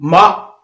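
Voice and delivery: short falling tone on माँ; fourth tone. Shown as a demonstration. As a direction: snap माँ straight down. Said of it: "अब उसमें माँ कैसे पढ़ेंगे वो भी देखिए".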